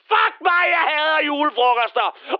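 high-pitched burst of comic despair; theatrical, high exasperated rant; exasperated outburst. Acted. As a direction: explode upward in fed-up frustration.

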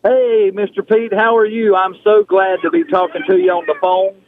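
usual speaking voice

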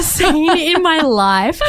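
slow, high giggling words; giggly, high voice; laughing. Real, not acted. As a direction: laugh through it. Laughing about the handsome doctor.

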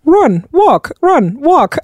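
mock British voice, pitched high; doing a bad British app voice, clipped commands; a British running coach in a bad British accent. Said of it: "that's my bad British accent".

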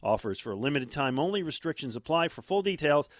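punchy radio ad read; infomercial. Acted. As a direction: sell it like a live commercial. Live ad read.

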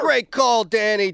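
high, loud "Great call"; excited praise. Real, not acted. Host cheering the caller's draft day call, excited.

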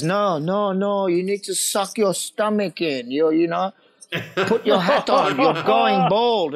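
slow, deliberate imitated instructions; Japanese commercial director. Director corrects the actor during filming, slow deliberate imitation. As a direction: mimic the director, stretching out each order. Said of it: "He goes".

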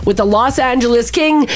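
upbeat radio sports update; sports news read. Acted. Reads hockey news.

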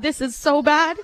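normal talking voice

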